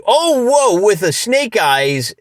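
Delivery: high, slowed whoa of dismay at the dice; surprised whoa. Real, not acted. Solo wargamer reacting to snake eyes, surprised and dismayed. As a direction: gasp at the bad roll, drawn out.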